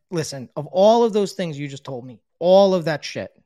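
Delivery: loud, forceful sponsor voice; raising the voice to cut through the rant; firm telling-off. Retold or imitated. Sponsor cutting him off firmly, loud and forceful.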